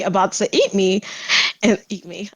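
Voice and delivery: ordinary talk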